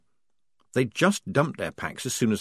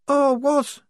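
high, loud little cry of dismay; high dismayed girl; 5 dB louder than usual. A narrator's reading. Shufti reacting to the news, high and upset. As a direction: squeak out the dismay.

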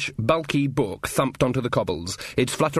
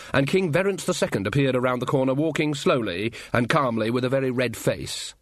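ordinary delivery, nothing marked

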